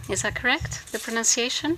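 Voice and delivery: ordinary talk, nothing marked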